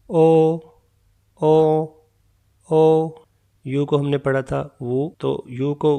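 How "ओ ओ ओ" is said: "ओ" with rounded lips, each one separate; Chinese o. Shown as a demonstration. Teacher modelling "ओ" for learners. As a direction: say "ओ" plainly, three times. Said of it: "ओ ही है".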